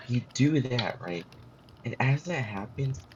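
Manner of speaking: ordinary talk, nothing marked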